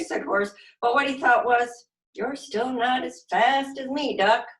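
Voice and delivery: put-on lively, expressive read-aloud for little kids; animated children's story narration; storytime reading. A narrator's reading. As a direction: read it like a silly picture book to a class. Reading picture book to children.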